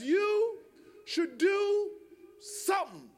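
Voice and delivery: grand build-up to a deflated SOMETHING; suspenseful pronouncement. Retold or imitated. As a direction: pause after YOU and DO, drop on SOMETHING. Neighbor's suspense then anticlimax.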